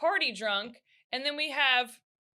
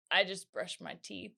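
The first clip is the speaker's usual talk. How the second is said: low, soft deadpan; flat oblivious reply, much softer; deadpan sister; 8 semitones lower, 8 dB quieter than usual. Retold or imitated. Sister's calm deadpan answer.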